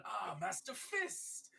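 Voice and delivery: big delighted in-character greeting; hearty character greeting. Acted. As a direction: hail an old friend across the tavern. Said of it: "he says in common".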